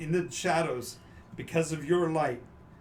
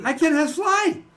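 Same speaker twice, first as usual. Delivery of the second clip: high voiced character; 11 dB louder than usual. Dragon answering in character.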